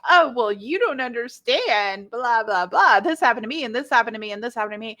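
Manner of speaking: loud, defensive retort, re-enacted; mad outburst. To Spirit on being told to forgive, mad and defensive. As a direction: act out an angry knee-jerk protest, piling up the grievances. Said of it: "And I got really mad".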